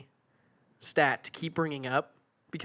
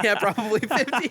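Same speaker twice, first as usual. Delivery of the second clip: laughing; 7 semitones higher, 7 dB louder than usual. Real, not acted. Laughing about fishing rod.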